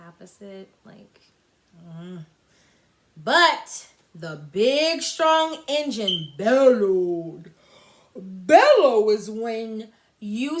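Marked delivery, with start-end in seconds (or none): 3.1-7.5 slow, loud, weighty narration; dramatic narration. A narrator's reading. Teacher narrating the proud engine, slow and loud. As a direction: build up the big engine.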